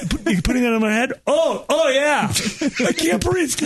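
high-pitched mock smothering panic; mock alarm. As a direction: play at being smothered, voice rising high. Acts out being smothered by pillow.

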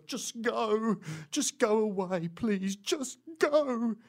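high mock-pleading voice; hammed-up, high plea; upset woman pleading. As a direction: imitate a distraught woman begging someone to leave.

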